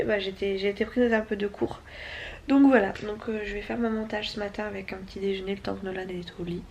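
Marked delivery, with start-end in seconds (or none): none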